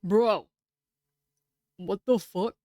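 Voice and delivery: sudden loud outburst; loud shout. Real, not acted. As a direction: blurt it out loud. Shocked reaction to game.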